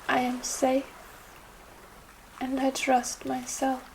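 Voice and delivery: unhurried yoga nidra guidance with long pauses; guided meditation. Acted. Yoga teacher guiding listeners through Yoga Nidra for trust, meditative. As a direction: guide them gently, phrase by phrase, leaving space.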